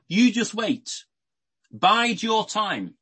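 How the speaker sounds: Paul calmly warning his critics, slow; patient warning. Retold or imitated. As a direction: tell them to wait, drawn out and assured. Paul voiced telling critics to wait.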